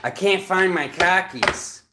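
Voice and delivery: high, loud exasperated skit voices; comic accent skit. Acted. Acting out the khakis mix-up, high loud comic voice. Acts out a khakis/car keys joke.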